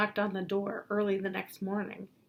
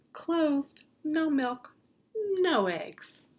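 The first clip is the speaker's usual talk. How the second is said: higher, slightly louder cow voice; 8 semitones higher, 5 dB louder, much slower than usual. Acted. Cows on strike post a note to the farmer, high-voiced. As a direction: pipe up as the cheeky cows.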